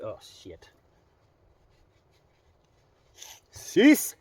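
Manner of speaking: sobbing, voice breaking; after crying. Real, not acted.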